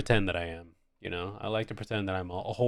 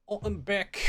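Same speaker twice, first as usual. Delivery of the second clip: put-on higher, playful English accent; 10 semitones higher than usual. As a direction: switch into a cheeky English accent. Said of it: "I go into doing English accents".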